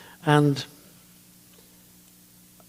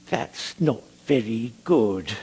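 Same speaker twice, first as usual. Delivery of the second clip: lightly voiced slow, drawn-out older conductor; a hint of Francis's slow, unhurried critique; slow way of talking; 4 semitones lower, 3 dB quieter, slower than usual. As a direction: draw the words out gently and slowly. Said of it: "he had a lovely, slow way of talking".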